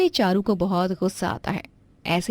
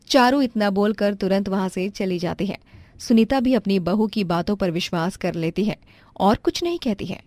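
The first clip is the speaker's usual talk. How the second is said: usual speaking voice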